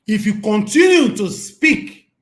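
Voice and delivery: angry warning. Real, not acted. Vlogger warning biased commenters, slow angry shout. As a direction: threaten them word by word.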